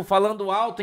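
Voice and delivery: usual speaking voice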